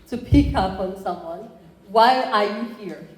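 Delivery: normal talking voice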